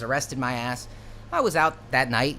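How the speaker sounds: normal talking voice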